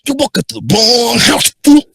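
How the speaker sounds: hammed-up cartoon thug; snake voice. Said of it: "do the big snake voice".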